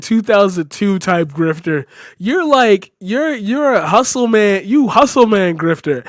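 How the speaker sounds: loud, angry ranting shout; furious yelling. Real, not acted. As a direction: yell at her in real rage. Raging at the video's host.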